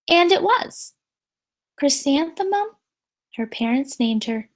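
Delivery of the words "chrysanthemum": plain reading voice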